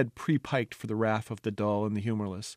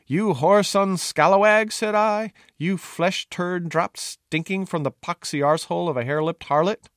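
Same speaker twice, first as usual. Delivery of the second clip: shouted insults; 7 semitones higher, 7 dB louder than usual. Acted. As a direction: hurl the abuse down at the bastard. Jester hurling insults from the wall.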